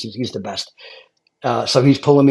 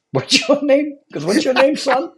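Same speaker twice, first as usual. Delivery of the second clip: high, pressing question from the chief; a fire chief sizing up a rookie, much higher; gruff chief voice; 12 semitones higher than usual. Retold or imitated. Fire chief grilling the young lieutenant, raised pitch.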